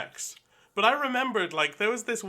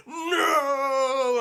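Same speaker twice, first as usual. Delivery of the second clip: long, high slow-motion "no"; drawn-out mock-dramatic cry; shouted no; 9 semitones higher, 4 dB louder than usual. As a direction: stretch it out like a film hero leaping. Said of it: "and I shout".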